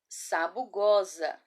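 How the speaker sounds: Sabugosa joined up, slow and drawn out; read as whole word. Shown as a demonstration. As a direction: read Sabugosa slowly without breaks between syllables. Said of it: "Agora vamos ler?".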